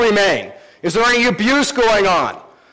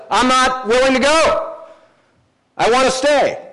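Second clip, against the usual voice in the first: higher, lightly voiced eager slave; voicing the slave; 6 semitones higher than usual. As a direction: plead to stay, brightly earnest. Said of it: "this indentured person ... says".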